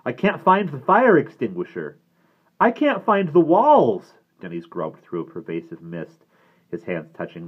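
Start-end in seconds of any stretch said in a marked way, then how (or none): 0.0-4.1 high, loud cries building in panic; panicked yell. A narrator's reading. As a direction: yell out, losing your bearings. Yelling, lost in the mist.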